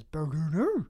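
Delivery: loud mock monster gibberish; Grimace noises. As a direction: blurt it as a creature's call. Voicing Grimace's nonsense speech.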